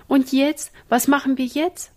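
high, loud and helpless; helpless question. Acted. Ina asks her brothers what to do now, helpless and loud. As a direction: ask in helpless alarm. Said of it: "fragte Ina ratlos".

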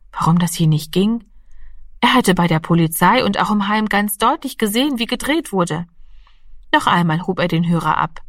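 ordinary delivery

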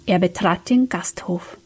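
usual speaking voice

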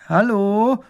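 loud, high call with a rising end. A narrator's reading. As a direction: call out to see if anyone is home. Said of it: "ruft Emil".

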